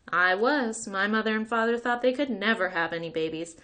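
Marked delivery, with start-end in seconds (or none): none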